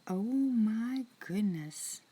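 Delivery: slow, breathless whispered gasp of shock. Real, not acted. Streamer hearing a viewer's frightening story: slow shocked whisper. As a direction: let the shock steal your voice.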